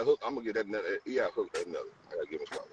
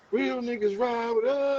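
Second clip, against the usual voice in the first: slow, higher, louder delivery; quoting him; 12 semitones higher, 5 dB louder, slower than usual. Retold or imitated. A rapper delivering his line, slow, higher and louder. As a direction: stretch it out like a hook. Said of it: "He said, he said".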